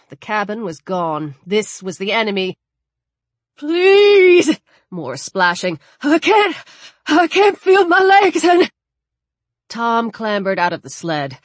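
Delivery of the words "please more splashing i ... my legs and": high, loud desperate begging; beg, freezing in water; desperate pleading. Acted. Drowning man begging.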